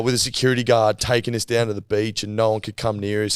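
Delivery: ordinary talk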